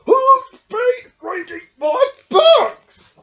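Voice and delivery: slow, loud, trembling voices; quavering. Acted. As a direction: tremble with fear of being found. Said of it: "Quavered four little voices".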